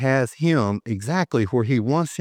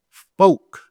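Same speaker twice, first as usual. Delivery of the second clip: spoke hit high and loud; stressed spoke; 8 semitones higher, 7 dB louder than usual. Real, not acted. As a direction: punch the word spoke. Preacher stresses key word.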